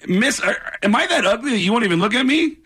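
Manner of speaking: high-pitched mock-offended appeal; needling stage comic. Opening comic singles out woman in audience, mock-offended. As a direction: address her as if snubbed.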